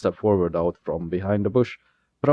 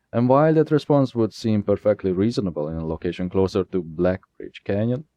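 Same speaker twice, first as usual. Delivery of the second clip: ordinary delivery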